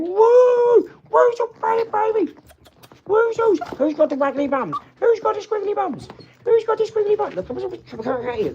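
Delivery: very high, loud cooing pet voice; baby talk to puppy. Acted. Owner calling the puppy in, very high baby talk. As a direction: coo sing-song at the wriggling puppy.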